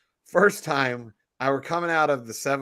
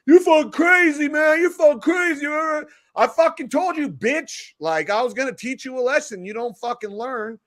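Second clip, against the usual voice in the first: high, loud frightened shouting; a hammed-up scared panhandler, higher and louder; mock panicked yell; 11 semitones higher, 4 dB louder than usual. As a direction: play the scared guy yelling back.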